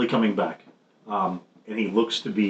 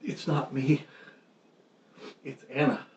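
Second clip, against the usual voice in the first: high, halting voice of a wounded man; shocked; 6 semitones higher, 4 dB quieter than usual. Acted. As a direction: plead it weakly in a higher pitch, with pauses. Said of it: "he looks shocked and says".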